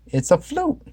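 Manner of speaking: high Daphne voice; matter-of-fact Daphne. A narrator's reading. Daphne explaining to Shaggy, high voice. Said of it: "said Daphne".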